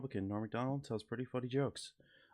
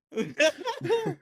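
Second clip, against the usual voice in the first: laughing; 7 dB louder, much slower than usual. Real, not acted. Laughing punchline squeak.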